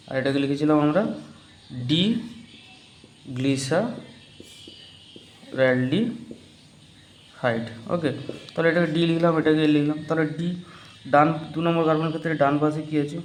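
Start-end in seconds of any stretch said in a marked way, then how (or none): 1.9-7.7 each syllable of ডি গ্লিসারaldehyde stretched very slowly; slow dictation. Shown as a demonstration. Teacher dictating a name for students to write down, very slow. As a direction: spell it out syllable by syllable.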